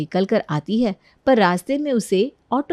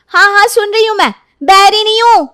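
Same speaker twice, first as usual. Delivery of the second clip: high, loud exasperated snapping; irritated daughter; 12 semitones higher, 9 dB louder than usual. Acted. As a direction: yell back at a scolding mother. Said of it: "अपनी माँ से डांट खा रही थी". (झुंझलाकर ऊँची आवाज़ में चिल्लाते हुए)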